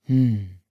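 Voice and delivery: low undecided hum; low grumble. A narrator's reading. Zoo director unsure whom to choose, grumbling low. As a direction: hum it low, deep in thought. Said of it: "brummte der Zoodirektor".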